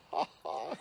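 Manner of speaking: high, breath-led mock laugh; acted-out scornful ha-ha; imitated mocking laugh. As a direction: laugh at the kid, put on. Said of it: "He started laughing at me".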